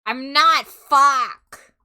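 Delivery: loud annoyed swearing; frustrated outburst. Real, not acted. Realizes wrong program.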